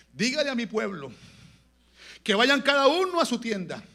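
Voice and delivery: loud, high proclamation; ringing declaration from the pulpit; full-force preaching. Real, not acted. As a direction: call out the command to the people. Preaches God's command at full force.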